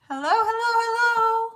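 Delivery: high, lilting hellos; sing-song greeting. Real, not acted. Streamer greeting viewers high and sing-song.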